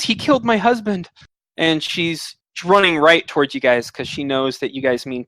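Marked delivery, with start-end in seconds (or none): 0.0-1.1 higher-pitched cry at normal volume; restrained version of a horrified wail; distraught woman. Acted. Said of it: "yeah, she says".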